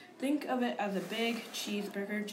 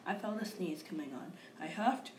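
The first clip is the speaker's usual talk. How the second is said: usual speaking voice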